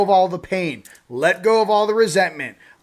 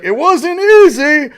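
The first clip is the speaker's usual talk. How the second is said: high, loud, slow put-on old man; hammed-up old sage voice; old man's reply; 10 semitones higher, 10 dB louder, slower than usual. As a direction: drag it out like a weary elder. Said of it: "the old Chinese man's like".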